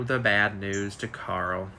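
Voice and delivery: slow, low, hushed ominous reading; dramatic reread. Shown as a demonstration. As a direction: lean into the bad news like a soap cliffhanger.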